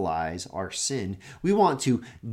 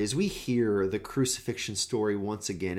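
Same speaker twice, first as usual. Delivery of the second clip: ordinary talk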